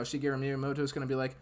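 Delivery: ordinary talk, nothing marked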